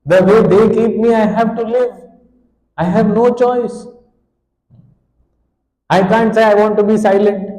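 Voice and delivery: high, loud, wailing plea; crying lament. Acted. Helpless infant crying out to God, wailing and pleading.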